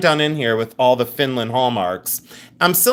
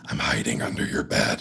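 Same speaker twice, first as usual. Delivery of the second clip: very low, softer monster growl; put-on man voice; 7 dB quieter than usual. Prank caller scaring own mother, deep monster voice. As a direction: menace them in a deep fake creep voice. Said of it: "It hurts my voice to sound like a man".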